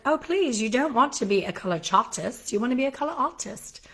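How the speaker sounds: put-on playful, half-convincing Aussie accent; imitated Australian accent. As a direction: mimic a friend's cute Australian accent. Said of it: "adorable Australian accent".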